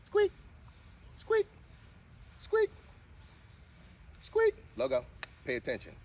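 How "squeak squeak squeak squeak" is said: very high squeaky "squeak", louder; squeaky high command. Shown as a demonstration. Owner coaxing her dog to squeak, very high and squeaky. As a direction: squeak the word like a dog toy. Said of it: "trying to get her to do a squeak".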